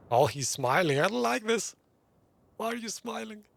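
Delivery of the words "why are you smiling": normal talking voice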